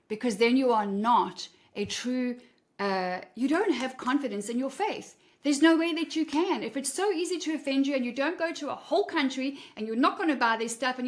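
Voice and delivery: ordinary talk, nothing marked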